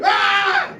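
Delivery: high, loud frightened scream; kids screaming. Retold or imitated. Kids at the door seeing the bloody stump, screaming. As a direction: shriek like terrified children. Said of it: "They go".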